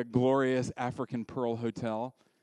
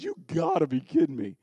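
the flesh protesting; 2 semitones higher, 7 dB louder than usual. Acted. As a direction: protest loudly as the incredulous flesh. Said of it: "The flesh over here goes".

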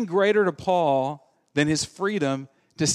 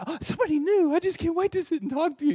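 much higher, fast, gushing seatmate; hammed-up chatterbox thrilled at a new seatmate; gushing chatty passenger; 13 semitones higher, faster than usual. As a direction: gush like an eager talker on a plane.

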